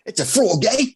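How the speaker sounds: put-on Michigan Australian accent. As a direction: echo it in a half-Australian, half-Canadian voice. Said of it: "sounds like that's full on Australian".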